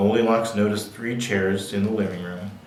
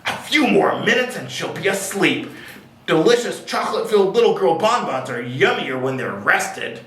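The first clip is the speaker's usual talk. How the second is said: higher-pitched, gleeful storybook villain; gloating dinosaur; 5 semitones higher than usual. A narrator's reading. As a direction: gloat over the coming snack. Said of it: "or a dinosaur gloating".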